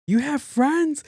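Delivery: loud, very high disbelieving question; mock astonished mom. Mom doubting her daughter's social life, mock astonishment. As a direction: gasp the question as a stunned mother.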